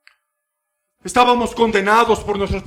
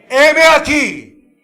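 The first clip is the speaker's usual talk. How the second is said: high, loud, slow declaration; loud, stretched-out here I am; bold proclamation; 9 semitones higher, 8 dB louder, much slower than usual. Acted. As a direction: offer yourself boldly, drawn out. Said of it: "debería estar dispuesta a decir exactamente lo mismo".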